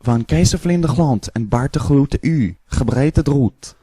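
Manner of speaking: Dutch-sounding gibberish; fake Dutch. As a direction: babble like Dutch. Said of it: "now we do the Dutch".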